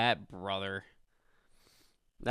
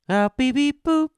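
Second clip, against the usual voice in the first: squeaky robot beeps; high beeping; 14 semitones higher, 7 dB louder than usual. Pretend.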